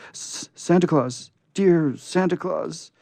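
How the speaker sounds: high, loud, slow tearful cry; sobbing plea. Acted. Scarecrow calling Santa in the dark, sobbing. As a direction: beg through a great sob. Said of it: "cried Jimmy Scarecrow with a great sob".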